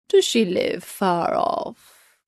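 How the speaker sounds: the wolf booms his question slowly. Acted.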